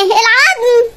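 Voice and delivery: ordinary delivery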